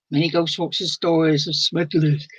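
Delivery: talking through a real yawn; words swallowed by a yawn, voice dropping away. Real, not acted. Host reading a viewer's comment again while tired, yawning. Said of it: "I'm, I'm yawning".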